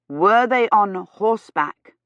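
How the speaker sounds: "Were they on horseback" slow and over-distinct; every word emphasized. A narrator's reading. Gawyn questioning a lady he thinks deaf, slow and emphatic. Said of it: "emphasizing every word".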